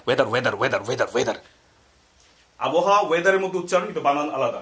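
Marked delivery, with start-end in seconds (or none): 0.1-1.3 low, rhythmic repetition; lowered rote chant; low drill. Shown as a demonstration. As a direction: drill the word in a dropped voice.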